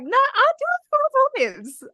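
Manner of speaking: very high, squeaky insistence; mock protest. Retelling the answer to Josie, squeaky mock protest. Retold childish insistence on loving olives.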